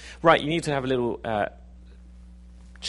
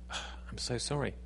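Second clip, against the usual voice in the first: contrite; 10 dB quieter than usual. Acted. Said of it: "put our hands up and say".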